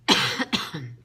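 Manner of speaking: a loud harsh cough; coughing fit. Real, not acted. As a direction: cough hard, breaking off the sentence. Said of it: "I'm sorry I'm coughing so much".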